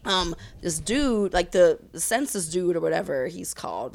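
usual speaking voice

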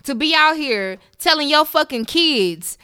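real exasperated telling-off; indignant scolding. Real, not acted. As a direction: hit each word harder as the rant builds. Her own rant at the man.

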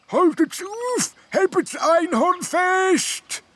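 very high, slow stretched gnome cry; a distant little creature calling stop. A narrator's reading. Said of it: "die immer wieder ruft".

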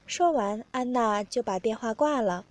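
ordinary delivery, nothing marked

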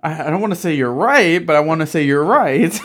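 giggly, loud concession; laughing. Real, not acted. Friends cracking up on podcast.

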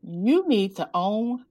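slow, deliberate retold pronouncement; weighty advice. Young woman advising the author to own it, deliberate. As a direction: spread the words out, letting each land.

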